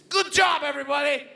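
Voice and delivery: loud, high, hammed-up cheer; mock cheer. Comedian mocking school staff, hammed-up cheer. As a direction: shout it like a fake pep talk.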